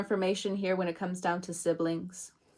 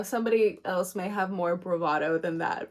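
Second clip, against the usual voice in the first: normal talking voice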